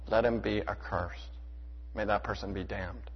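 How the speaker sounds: hushed gravity. Real, not acted. Preacher drops voice after quoting curse.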